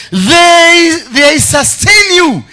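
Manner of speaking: slow, high, loud proclamation; preacher's shouted emphasis. Real, not acted. Preacher on friends who sustain you, shouting slow and high. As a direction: belt it out, stretching the words.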